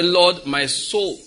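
slow earnest prayer to the Lord. Shown as a demonstration. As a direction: draw out the prayer.

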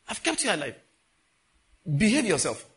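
high, loud acted rebuke; forceful warning. God's warning repeated with force.

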